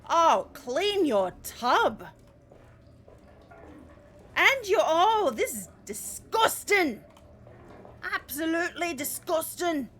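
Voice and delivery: high, slow, loud disgust. Real, not acted. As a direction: recoil in disgust. Grossed out by dirty tub.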